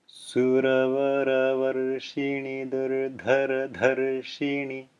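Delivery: slow Sanskrit recitation with tapped r sounds. Shown as a demonstration. Teacher slowly reciting the Sanskrit line for students to follow. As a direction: read it slowly, each r a light tongue tap. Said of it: "Again slowly".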